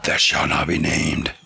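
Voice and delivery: sudden deep, doom-laden "shall not be named"; ominous voice. Pretend. Co-host teasing about the unnamed episode, ominous deep intoning. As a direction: intone it like a dark legend.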